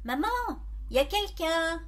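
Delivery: mild shout to another room; calling out. A narrator's reading. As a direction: call through the house, questioning. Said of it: "Je crie".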